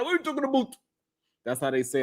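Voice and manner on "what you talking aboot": high-pitched mock Canadian "aboot"; light Canadian accent, playfully put on; Canadian accent impersonation. Host greeting a viewer from Canada, mock Canadian accent. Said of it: "That's my impersonation of the accent".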